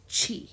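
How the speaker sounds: "chi" said chee; CH sound opening "chi". Shown as a demonstration. Teacher sums up the C rule, "chi" with CH. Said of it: "They're gonna make it a C H".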